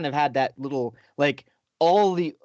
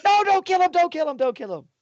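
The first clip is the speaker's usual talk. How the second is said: panicked plea; 5 dB louder, faster than usual. Retold or imitated. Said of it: "he's like".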